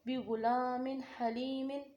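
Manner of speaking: बि गुलामिन हलीमिन recited slowly, each syllable drawn out, clear ن at the end; slow Quranic demo of बि गुलामिन हलीमिन; slow recitation demo. Shown as a demonstration. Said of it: "तो कैसे इसको पढ़ेंगे?".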